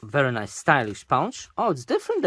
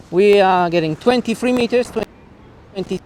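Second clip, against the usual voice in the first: calling out from afar; 7 semitones higher, 5 dB louder than usual. Real, not acted. Shouting to distant camera outdoors.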